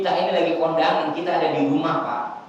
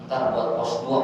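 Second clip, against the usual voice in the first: low, soft, fast lazy mumble; lazy homebody; 7 semitones lower, 5 dB quieter than usual. Retold or imitated. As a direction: grumble like a man who won't leave home. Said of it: "begitu katanya". (gumaman malas, cepat dan pelan)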